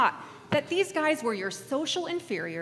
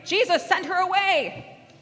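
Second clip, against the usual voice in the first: high, raised-voice complaint; acted demand; 8 semitones higher, 4 dB louder than usual. Disciples fed up with the woman, acted demand. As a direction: call it out for the group to repeat.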